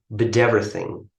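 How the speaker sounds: "but everything" linked as "bid everything"; linked pronunciation. Shown as a demonstration. Teacher repeats how natives say "but everything", linked. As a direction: run "but everything" together like a native speaker. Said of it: "as many people say".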